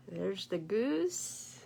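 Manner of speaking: usual speaking voice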